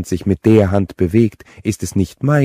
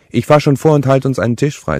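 much higher and louder, brisk sister voice; Deborah deciding; 8 semitones higher, 6 dB louder than usual. A narrator's reading. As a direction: push it up high and decisive. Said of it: "sagte sie". (Deborah beschließt vorzufahren, deutlich höher und lauter)

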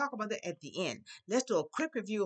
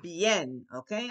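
ordinary talk, nothing marked

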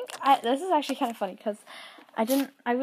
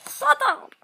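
much higher, louder snap; mock yell; 6 dB louder than usual. Real, not acted. Telling the noisy kids off, playful yell. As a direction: shout it half-joking.

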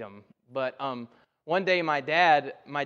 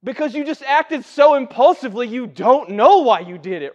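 ordinary talk

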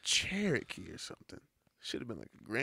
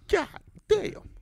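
shocked exclamation; 10 dB louder than usual. Real, not acted. Shocked at late hour.

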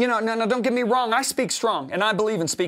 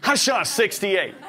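excited boast; 5 semitones higher, 4 dB louder than usual. Retold or imitated. Calling wife about golf.

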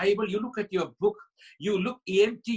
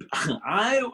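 Sarah's laugh; 5 dB louder than usual. Acted. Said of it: "now Sarah says".

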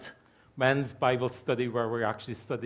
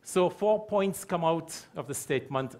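normal talking voice

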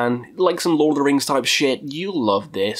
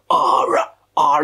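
growl; 4 dB louder than usual. Retold or imitated. Growling along to death metal.

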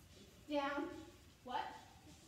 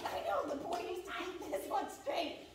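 normal talking voice

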